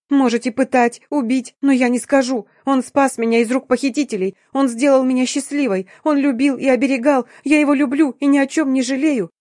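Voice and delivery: high, tearful defiance; sobbing warning. A narrator's reading. Sobbing girl defends her husband to the boss, high and fervent. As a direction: pour it out high and impassioned. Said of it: "продолжая всхлипывать, предупредила".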